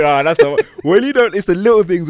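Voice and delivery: giggling, pitched right up; laughing excitedly. Real, not acted. Man joking about petty marriage annoyances, laughing high.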